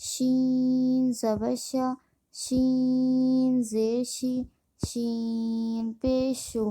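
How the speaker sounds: شین held long on its ee, then the rest short; slow spelling drill, long-drawn شین; elongated madd. Shown as a demonstration. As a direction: stretch the vowel in شین for five counts. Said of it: "مد ہوگا اسے ہم پانچ الف کھینچیں گے".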